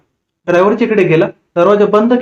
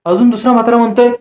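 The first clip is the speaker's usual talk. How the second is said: usual speaking voice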